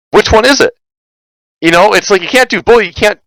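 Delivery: high incredulous exasperation; exasperated. Real, not acted. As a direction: voice jumps high in disbelief. Exasperated at contradictory demands.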